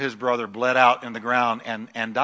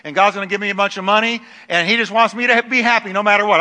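fast, lightly voiced complaining listener; imagined congregation member; 6 semitones higher, 6 dB louder, faster than usual. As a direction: rattle off a mock protest.

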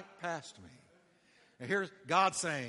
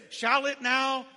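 ringing scripture cry; 7 semitones higher, 4 dB louder, much slower than usual. Acted. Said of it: "here it is".

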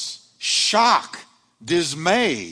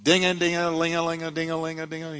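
bell ringing; 2 semitones higher, faster than usual. Pretend. Mock alarm bells ringing.

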